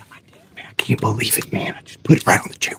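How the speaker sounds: unvoiced excited whisper. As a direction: hush the victory. Still hushed after the drop.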